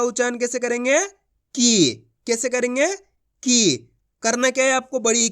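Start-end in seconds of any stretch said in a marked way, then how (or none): 1.5-1.9 की with the ee held long; drawn-out long ee. Shown as a demonstration. Teacher sounds out क with long ee, vowel drawn out. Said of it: "खींच के पढ़ा जाता है". 3.4-3.8 की with a long, sustained ee; drawn-out long ee. Shown as a demonstration. Teacher repeats the answer for learners, vowel drawn out. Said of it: "खींच के पढ़ा जाता है".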